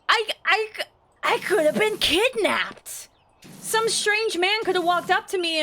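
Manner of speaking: incredulous outrage. Real, not acted. Adult telling host how she could have been kidnapped, high incredulous outrage. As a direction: disbelief rising in the voice.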